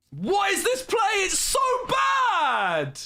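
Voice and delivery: very high, slow, stretched-out shout of disbelief; outraged shriek. Real, not acted. Streamer shrieking at the opponent's terrible play, high outraged wail.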